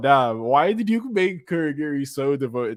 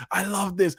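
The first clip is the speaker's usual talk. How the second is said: higher, delighted burst; pitched-up fan delight; excited; 8 semitones higher than usual. Real, not acted. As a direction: say it with fan joy. Gushing over anime scene.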